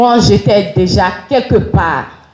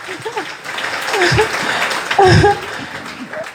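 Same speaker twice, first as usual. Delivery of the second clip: long high soft laugh; laughter; 9 semitones higher, 5 dB quieter than usual. Real, not acted. The speaker laughing at her own story, soft laugh. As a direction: let a real laugh roll out. Laughter after joyful quote.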